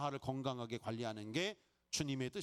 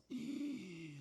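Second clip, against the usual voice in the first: mock gasp; 2 semitones higher, 5 dB quieter than usual. Shock at thirty billion.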